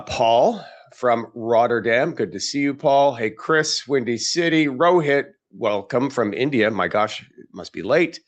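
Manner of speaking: normal talking voice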